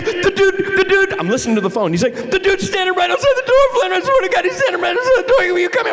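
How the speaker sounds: very high, loud mock terror; high frantic plea; panicked friend. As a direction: squeal it, laughing with fear. Friend whispering panic on phone.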